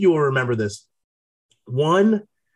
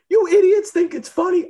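much higher, louder angry coach impression; 3 dB louder than usual. Imitating the coach scolding players over beers, high and loud. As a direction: bark it like the furious coach.

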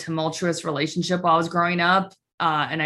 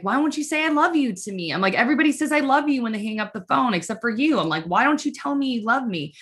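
high, whiny plea; imitating her younger self begging for love; teen daughter pressing her mom, high and whiny; pleading teen; 6 semitones higher, faster than usual. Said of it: "And then I was like".

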